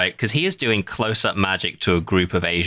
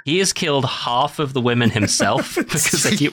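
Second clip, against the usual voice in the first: laughing; 6 semitones higher than usual. Real, not acted. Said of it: "laughing while telling".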